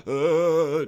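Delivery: gasp, then a drawn-out groan; imitated gasping groan. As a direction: mimic a dazed, wordless groan. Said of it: "He's basically like".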